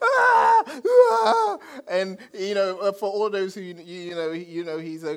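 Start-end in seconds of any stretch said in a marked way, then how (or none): 0.0-1.6 high excited laugh. Retold or imitated. Laughing after recognizing celebrity.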